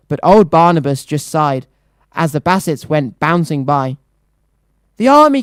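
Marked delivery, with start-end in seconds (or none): 5.0-5.4 high, loud panicked cry. Acted. Mayor crying out for the army, loud and high. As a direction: blurt it out in alarm. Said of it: "cried the mayor".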